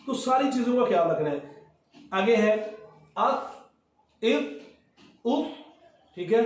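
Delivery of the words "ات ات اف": ات اف stopped short with no added vowel; clean stop. Shown as a demonstration. Teacher warns against extra sound after ت. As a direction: cut ات dead, no trailing sound. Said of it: "تھوڑی سی آواز آگے تو غلط".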